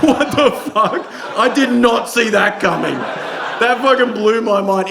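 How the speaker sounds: high, slow mock disbelief; retold astonishment. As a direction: stretch it out, mildly stunned. His amazed reaction to the tale.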